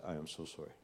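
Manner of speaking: low, soft, understated apology; retold tearful apology. Man retelling his apology to God, subdued and sorrowful. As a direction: quietly retell a sorrowful plea, voice dropping. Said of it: "I started bawling".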